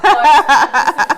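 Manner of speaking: loud, slow laughing burst; laughing outburst; laughing exclaim. Real, not acted. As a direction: blurt it out laughing.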